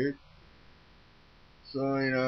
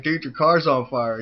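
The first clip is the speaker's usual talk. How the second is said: loud, higher-pitched retold alert; raised-voice mock alarm; retold urgent warning; 4 semitones higher, 9 dB louder than usual. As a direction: act out warning a friend urgently. Warning friend the car burns.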